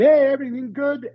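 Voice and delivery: high, bright call over the noise; a pilot shouting a quick check. Retold or imitated. Someone checks on him in helicopter.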